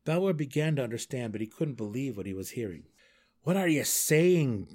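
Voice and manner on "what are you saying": loud, drawn-out, higher-pitched demand; incredulous raised-voice question. A narrator's reading. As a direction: demand it in disbelief. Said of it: "he demanded".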